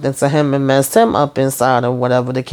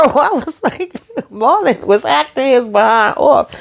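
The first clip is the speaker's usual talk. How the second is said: real laughter breaking through, voice pitched high; laughing; 8 semitones higher than usual. Real, not acted. Telling viewers how good Marlon was, laughing. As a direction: laugh out the praise for the actor.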